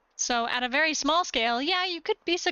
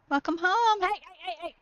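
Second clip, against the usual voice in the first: higher, bright sing-song greeting; excited welcome; 6 semitones higher than usual. Real, not acted. Greeting her dog at the door, high excited welcome. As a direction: gush at the dog coming in.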